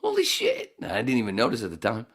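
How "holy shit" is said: very high, awed exclaim; rewinding the clip, stunned; amazed holy shit. Retold or imitated. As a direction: breathe it out in wonder. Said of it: "punched it back a little bit going".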